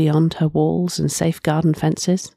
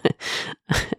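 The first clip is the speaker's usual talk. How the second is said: quiet airy laugh through tears; soft breathy laugh; 6 dB quieter than usual. Acted. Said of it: "I laugh".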